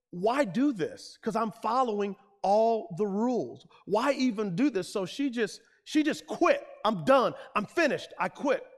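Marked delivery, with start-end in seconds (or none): 0.1-4.9 mildly raised, higher exasperated voice; retold fed-up protest, pitched up. Preacher voices a woman tired of following rules, exasperated. 6.8-8.7 acted fed-up outburst, pitched up; emphatic retold declaration of quitting. Preacher voices a woman giving up on church, fed up.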